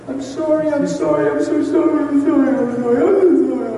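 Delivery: high-pitched, rushed tearful apology; imitating crying wife. Wife running to her husband apologizing over and over, imitated high cry. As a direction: mimic her repeating it in a high wail. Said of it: "guess what she's saying on her lips".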